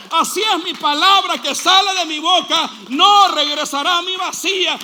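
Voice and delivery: loud, climbing proclamation of scripture; shouted scripture. Acted. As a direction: proclaim it at the top of the voice. Lee Isaías gritando. (proclamando la Escritura a gritos)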